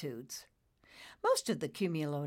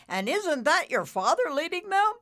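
high, loud rising question; excited announcing; 12 semitones higher, 8 dB louder than usual. Acted. Bullicus spotting Nimbus's father, loud and excited. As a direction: call it out with excitement.

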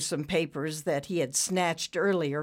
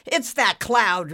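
loud, drawn-out, higher cry; shout; 5 semitones higher, 7 dB louder, slower than usual. A narrator's reading. Boss spotting the culprit cloud, shouted. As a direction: shout out the accusation. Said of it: "Ricky shouted".

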